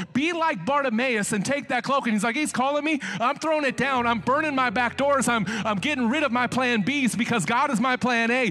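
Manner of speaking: high, loud climax of a sermon; preaching. Acted. Preacher calling the congregation to throw the cloak down. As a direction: build it up and hammer each line.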